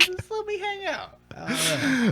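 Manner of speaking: mock whiny plea. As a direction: beg the bouncer in a put-on voice.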